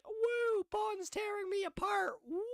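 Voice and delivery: high mock wail, way up; a hammed-up high-pitched woo; mock woo cry. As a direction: squeal it high like a silly fake cry. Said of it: "that's not like".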